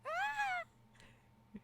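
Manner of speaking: long, very high squeal. Pretend. Streamer reacting to a violent shake emote, mock-alarmed squeal. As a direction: let out a sustained high-pitched squeal.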